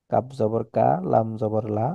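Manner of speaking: ordinary talk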